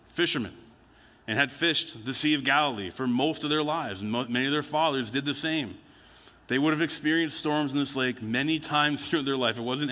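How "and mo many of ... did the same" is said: ordinary talk, nothing marked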